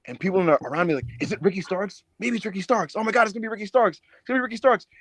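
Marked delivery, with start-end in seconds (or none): 1.2-4.9 higher, building and quickening; mock excited chatter. Fans around him guessing the surprise opponent, rising mock excitement. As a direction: imitate fans working each other up.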